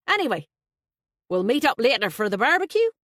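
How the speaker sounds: loud, high, hearty Irish voice; a flustered, jovial Dubliner blurting out; Irish best man. Acted.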